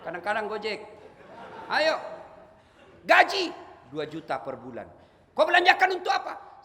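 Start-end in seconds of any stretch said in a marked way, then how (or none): 1.7-6.6 high, loud mocking questioner; mock interrogator. Preacher mimics an angel grilling the poor, loud mocking questions.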